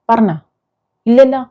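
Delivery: usual speaking voice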